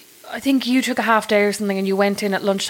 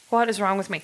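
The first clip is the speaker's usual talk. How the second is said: normal talking voice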